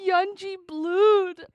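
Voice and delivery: loud, high, slow "Yunji Blood" with extra EE; unhinged mispronunciation. Retold or imitated. Repeating the DJ's error in hysterics, "[name]" high and loud. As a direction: cry out "Yunji Blood", YUN-jee-blood. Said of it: "He's never pronounced it like that before".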